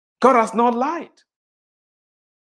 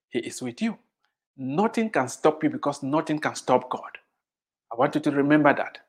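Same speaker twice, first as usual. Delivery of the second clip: soft reassurance; 7 semitones lower, 7 dB quieter than usual. Real, not acted. Preacher softens to reassure.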